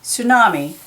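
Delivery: Tsunami said as soo-nah-mee with no T sound; silent T. Shown as a demonstration. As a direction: drop the T in Tsunami and start on the S. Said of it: "there's the silent T on tsunami".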